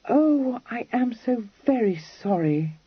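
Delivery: sorry from the heart, gently; contrite. A narrator's reading. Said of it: "said Kitty contritely".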